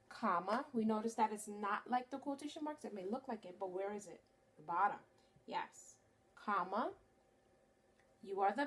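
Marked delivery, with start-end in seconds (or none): none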